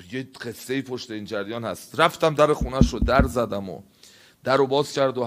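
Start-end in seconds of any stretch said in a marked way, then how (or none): none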